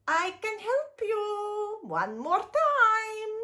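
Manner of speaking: little imp. Acted. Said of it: "said the little imp".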